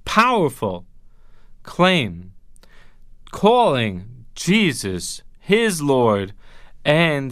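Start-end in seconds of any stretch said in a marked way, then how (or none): none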